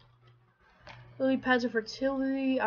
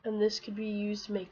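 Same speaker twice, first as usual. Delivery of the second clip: ordinary delivery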